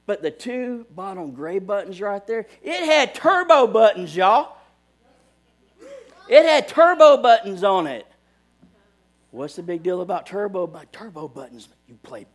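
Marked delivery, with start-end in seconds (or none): 2.6-8.1 loud, high-pitched hype; excited. Real, not acted. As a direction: shout it out like big news. Hyping the controller's turbo buttons.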